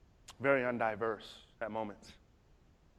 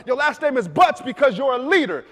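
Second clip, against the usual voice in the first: loud; 10 semitones higher, 12 dB louder than usual. Retold or imitated. Dad's loud repeat.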